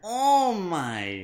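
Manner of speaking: loud, high drawn-out exclamation; exaggerated, stretched mock-scandalised delivery; hammed-up catchphrase. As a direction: swoop the words out big and loud. Playful catchphrase impression, echoed.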